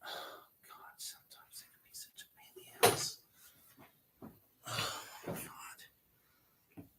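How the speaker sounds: barely audible whisper to oneself; whispered exasperation. Real, not acted. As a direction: whisper it under your breath, weary with yourself. Muttering to self in frustration.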